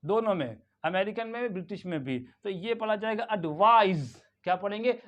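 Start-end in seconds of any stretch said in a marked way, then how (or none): 3.3-4.2 "एडवाइज" ending in a buzzed z. Shown as a demonstration. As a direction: say "एडवाइज" as uhd-VAIZ, second syllable loud. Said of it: "it becomes Z".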